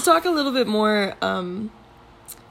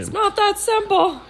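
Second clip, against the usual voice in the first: whiny, pitched way up; high whiny put-on voice; 10 semitones higher than usual. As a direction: play the doubter in a squeaky voice. Mock argument in put-on voice.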